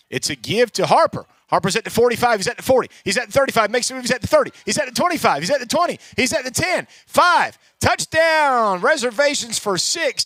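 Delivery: building from brisk to a shouted touchdown; rising excitement, climaxing on the score; excited touchdown call. Real, not acted.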